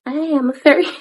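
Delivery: high, playful fairy voice; hammed-up high fairy; mock fairy. Speaker mocking the fairy tone they advise against, high playful voice.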